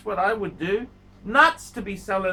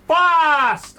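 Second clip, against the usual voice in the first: higher, louder punched word fast; mock dramatic shout; 7 semitones higher, 9 dB louder than usual. Real, not acted. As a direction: hammer home the joke. Punchline of plea.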